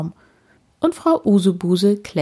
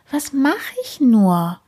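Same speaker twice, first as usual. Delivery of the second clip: higher, slow, helpless worry; worried; 7 semitones higher than usual. A narrator's reading. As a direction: fret slowly, stuck high in the tree. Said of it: "Sie hat Angst".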